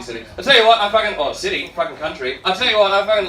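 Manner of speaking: usual speaking voice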